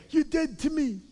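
high, stagey mob boss line; Godfather impression. Voicing the Godfather to a tempting friend, mock mob boss. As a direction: cut them off like a mafia don. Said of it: "the Godfather".

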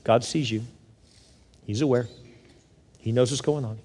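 low, hushed comfort; gentle reassurance. Real, not acted. Preacher comforting a struggling single mom, gently reassuring. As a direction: drop soft and low, letting each phrase settle.

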